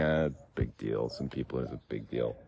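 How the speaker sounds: dismissive mutter. Real, not acted. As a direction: shrug it off under your breath.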